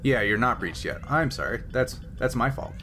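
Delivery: usual speaking voice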